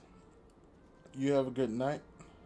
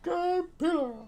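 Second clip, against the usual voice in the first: drawn-out high shout, much louder; dramatic announcement; 11 dB louder, much slower than usual. Pretend. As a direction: belt the name like a grand reveal. Dramatic shout of villain name.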